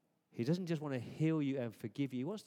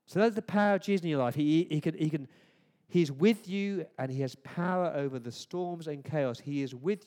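louder, higher and fast, building proclamation; rousing preaching; 4 semitones higher, 7 dB louder than usual. Acted. Preacher proclaims Jesus' power over death, rousing.

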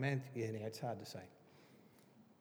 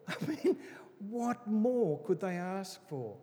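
incredulous laugh; 5 semitones higher than usual. Real, not acted. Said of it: "laughing disbelief at Pharisees".

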